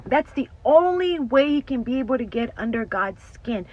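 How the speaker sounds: loud, rising preacher's warning; fiery sermon voice; preaching at full force. Real, not acted. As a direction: preach it out to the congregation. Preaching about the enemy.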